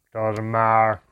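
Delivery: loud, gruff dismissal; gruff dwarf brush-off; grunting dwarf. A narrator's reading. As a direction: grunt it loudly as a rough dwarf. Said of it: "grunted the first dwarf".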